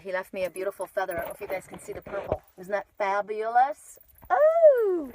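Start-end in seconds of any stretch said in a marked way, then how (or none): 4.3-5.1 sharp, high startled "Oh!"; surprised gasp. Real, not acted. Streamer startled by something on camera, sharp high gasp. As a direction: jolt of real surprise. Sudden sight during live stream.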